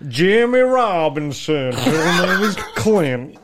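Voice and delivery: slow put-on Australian accent; mock Australian. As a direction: drawl it like a cheesy Aussie hero. Mocking Australian film hero.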